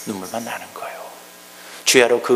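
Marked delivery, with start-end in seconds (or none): 0.1-1.0 tearful hushed echo. Shown as a demonstration. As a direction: repeat it softly as if near tears. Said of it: "echo repeat". (울먹이듯 작게 반복)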